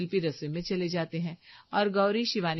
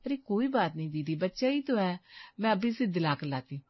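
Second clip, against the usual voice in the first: ordinary talk, nothing marked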